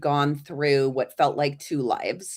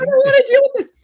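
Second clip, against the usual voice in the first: put-on much higher, loud childish protest; whiny refusal; 11 dB louder, much faster than usual. Granddaughter refusing to hear the warning, loud childish whine.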